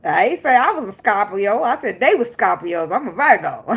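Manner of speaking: sing-song mock Southern accent, breaking into laughter; imitated Southern accent. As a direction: playfully mimic the commenter's Southern accent. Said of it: "your accent even types".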